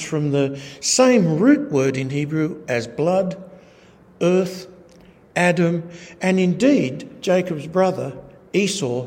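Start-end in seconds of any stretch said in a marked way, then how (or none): none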